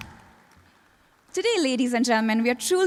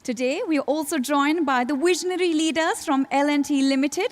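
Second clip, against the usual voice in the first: usual speaking voice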